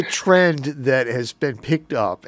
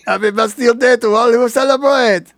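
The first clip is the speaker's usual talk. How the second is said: put-on loud, outrageous French accent; hammed-up French host voice; 9 semitones higher, 8 dB louder than usual. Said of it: "I can only do the French accent, and it's outrageous".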